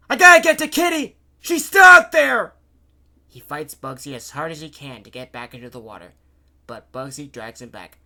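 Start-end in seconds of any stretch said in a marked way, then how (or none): 0.1-2.6 high, full-volume frantic plea; yell, fighting to get free; desperate shouting. A narrator's reading. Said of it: "Giff shouted".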